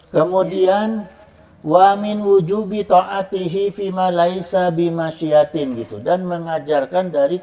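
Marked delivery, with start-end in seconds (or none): 1.6-5.8 recitation. Shown as a demonstration. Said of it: "reciting Arabic text slowly".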